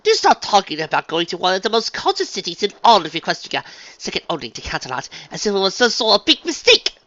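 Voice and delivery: high, loud posh disdain; disbelieving. A narrator's reading. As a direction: scoff in refined disbelief. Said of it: "rolled her eyes disbelievingly".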